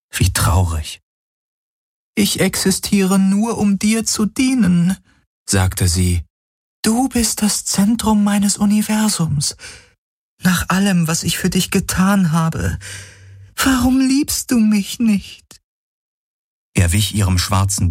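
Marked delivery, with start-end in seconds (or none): 2.2-5.0 very high, devoted female figure voice; pleading shadow apparition. Acted. Shadow being pleads to serve Galen, desperate. 6.8-15.6 very high, lovelorn female figure voice; desperate shadow apparition, pleading. Acted. Rejected illusion begs Galen for love, plaintive.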